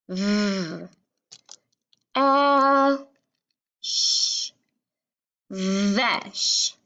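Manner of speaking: V, a and sh held apart, then stretched into vesh; drawn-out V, a, sh, then a smooth vesh; sounding out and blending. Shown as a demonstration. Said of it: "each word on the blending board".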